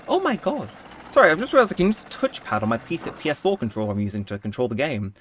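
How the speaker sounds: usual speaking voice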